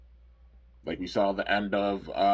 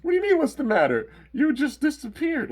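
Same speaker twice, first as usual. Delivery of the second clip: high-pitched incredulous outburst; hammed-up, squeaky-high protest; mock indignation; 5 dB louder than usual. Dan answering Drago who had vanished, high indignant disbelief.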